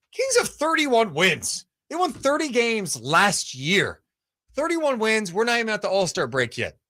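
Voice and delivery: voicing defensive fans. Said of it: "fan base that's like".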